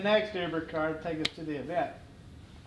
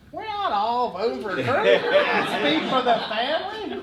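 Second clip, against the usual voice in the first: higher, rising objection on "not all"; indignant protest; 5 semitones higher, 5 dB louder than usual. Retold or imitated. Son answering dad's commercial, indignant higher protest. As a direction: object loudly on the family's behalf.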